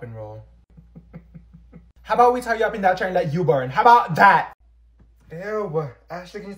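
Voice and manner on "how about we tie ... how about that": loud mocking threat; loud threat at the other student; angry threat. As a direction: snap back a threat. Threatening retort.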